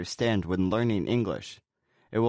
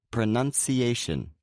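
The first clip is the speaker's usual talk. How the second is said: slow pro-NUN-ci-A-tion, main stress on the A; main and secondary stress. Shown as a demonstration. Teacher repeating the long word, main and secondary stress. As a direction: slowly show both stresses in pronunciation, A strongest. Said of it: "the A is the main stress".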